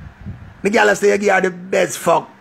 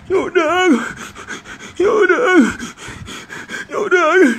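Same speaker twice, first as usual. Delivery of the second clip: high, whimpering "crying man" imitation; mock bawling; 10 semitones higher, much slower than usual. Imitating a friend crying to him, mock bawling. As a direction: sob it out in short mocking bursts. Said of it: "The man ball".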